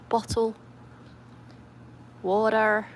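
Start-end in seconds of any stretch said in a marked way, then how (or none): 2.2-2.9 badly faked American accent; attempted American accent. Shown as a demonstration. Showing viewers how Americans say it, attempted American accent. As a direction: have a clumsy go at sounding American. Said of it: "I can't do the American accent".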